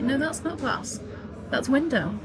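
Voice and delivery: mock teacher correcting a mistake; mock correction. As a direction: correct them like a know-it-all. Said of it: "I'm like".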